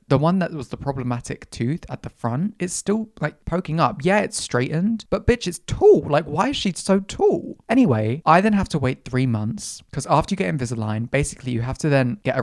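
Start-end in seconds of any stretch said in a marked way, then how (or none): 5.1-7.6 sassy outrage. Real, not acted. Outraged sass about tooth.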